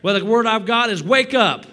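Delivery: loud, high, driving sermon voice; preaching at full force. Acted. Pastor preaching hard to his congregation. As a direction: preach it hard and build the room.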